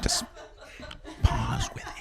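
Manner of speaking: breathy whisper, almost silent. Shown as a demonstration. Comic whispering the pun to the crowd, soft and airless. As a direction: whisper it like a meditation guide. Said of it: "You can do that".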